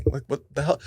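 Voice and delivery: usual speaking voice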